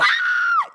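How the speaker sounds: short very high-pitched shriek; comic high scream; mock panic scream. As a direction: squeal out in playful panic.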